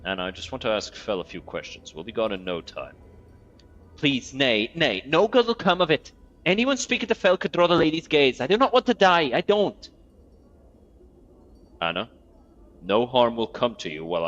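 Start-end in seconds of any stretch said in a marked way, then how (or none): 4.0-9.9 higher put-on accent, the speaker's take on Anna's accent. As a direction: voice Anna with a higher, borrowed accent. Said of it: "it's hard for me to copy this accent".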